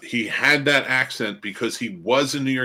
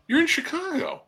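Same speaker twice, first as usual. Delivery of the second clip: high-pitched exasperated appeal; exasperated plea. Retold or imitated. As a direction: plead with a hopeless actor in a raised voice. Exasperated mock plea to the actors.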